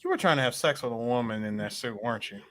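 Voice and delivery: low, soft accusation; a hint of a suspicious detective; accusing interrogator. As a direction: pin him down quietly. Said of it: "I stop him and go".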